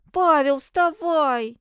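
loud, high, slow insisting; insistent call. Acted. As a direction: drag him out of bed by voice. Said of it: "не отступал Клеон".

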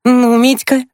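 put-on very high girlish exclamation; exclaiming. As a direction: exclaim to a brother in a high, lively voice. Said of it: "воскликнула Маша". (воскликнуть высоким девичьим голосом)

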